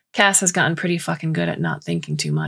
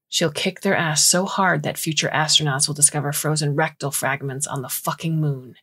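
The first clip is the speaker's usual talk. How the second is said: ordinary delivery, nothing marked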